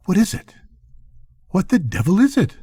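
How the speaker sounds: rising, alarmed asking; startled questions. A narrator's reading. Narrator voicing his startled self asking Morgan. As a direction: ask sharply, unsettled by the noise.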